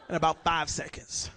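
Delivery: ordinary talk, nothing marked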